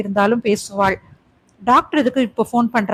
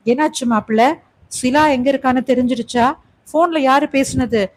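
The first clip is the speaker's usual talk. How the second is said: plain reading voice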